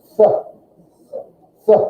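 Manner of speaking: a short "ts" for "S"; German Z sound. Shown as a demonstration. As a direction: hiss out "S" with a T in front. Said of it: "like there's a T in front of it".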